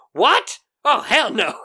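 high-pitched, incredulous "what?"; shocked outburst. Retold or imitated. Host voicing Judy learning of the cancer, shocked. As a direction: act out a stunned refusal, pitch shooting up. Acts out Judy's shocked refusal.

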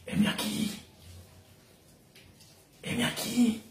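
soft, breath-only heme aquí; Isaiah offering himself in a near whisper; hushed reply. Acted. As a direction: answer God in a hushed voice. Isaiah answers God, hushed.

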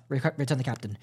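usual speaking voice

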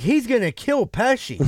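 imitating a worried friend; urgent warning. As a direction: warn a buddy someone will get hurt. Retelling friend's alarmed warning.

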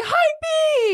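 high, far-off squeal; squealing commenters. Retold or imitated. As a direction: squeal it like excited fans. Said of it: "people in the comments were like".

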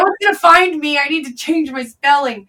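frantic realization; panicked. Retold or imitated. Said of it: "And I'm like".

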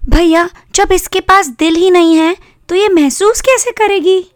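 put-on high-pitched girl arguing with her brother; high, questioning sister voice; protesting little sister. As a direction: plead the doll's case in a high voice.

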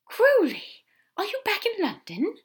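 high, loud, delighted astonishment; gasping surprise. Acted. Aziraphale realising Crowley is back, astonished. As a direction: burst out with a high, startled question. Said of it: "Aziraphale gasped".

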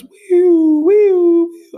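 very high, loud wailing siren sound; siren imitation. Narrator mimicking the ambulance coming, with a high loud siren wail.

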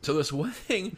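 words squeezed out through a choke; choking. Real, not acted. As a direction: voice catching while choking up. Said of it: "I was choking up".

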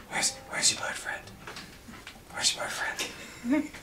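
hushed, secretive whisper; a sneaky NPC whispering to the player. Acted. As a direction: lean in and whisper the question. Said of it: "He looks down and he goes".

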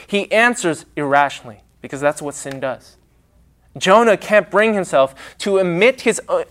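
ordinary talk

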